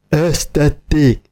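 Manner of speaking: a touch of stunned fury; mild outraged cry. A narrator's reading. Dörmögő úr cries out when the lunch is ruined. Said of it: "kiáltotta".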